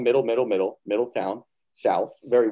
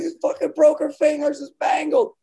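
much higher, louder frantic yell; panicked shout; 6 dB louder than usual. Retold or imitated. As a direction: shout in horror at the injury. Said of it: "I'm like".

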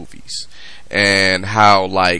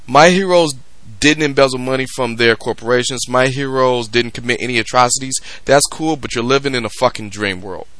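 ordinary talk

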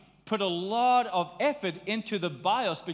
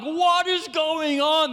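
exasperated; 8 semitones higher, 7 dB louder, slower than usual. Acted. Acted exasperation.

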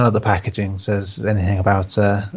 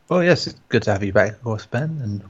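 ordinary delivery

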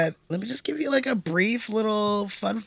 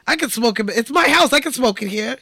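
higher, louder mock protest; indignant dad; 6 semitones higher, 4 dB louder than usual. Host voicing the dad defending his smoking, loud mock protest. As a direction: insist it's his house. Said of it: "acted quote".

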